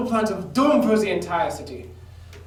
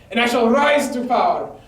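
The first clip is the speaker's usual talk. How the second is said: usual speaking voice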